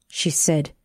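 plain reading voice

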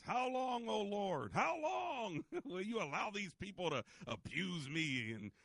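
high, slow anguished plea to God; emotional lament. Retold or imitated. Host voicing David's lament, high and slow. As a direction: cry it out like a wounded psalmist. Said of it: "really, like, emotional".

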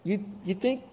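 scoffing question; incredulous apostle. Retold or imitated. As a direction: needle the congregation, half joking. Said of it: "he really speaks to Christians and he says".

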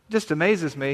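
normal talking voice

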